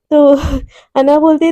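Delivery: usual speaking voice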